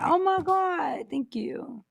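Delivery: high, slow mock delight fading off; fake gratitude. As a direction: gush with pretend thanks. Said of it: "I said".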